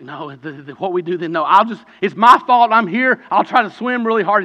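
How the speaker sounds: drowning man insisting he can swim; desperate refusal. Acted. Drowning man to rescuer, panicked rising plea.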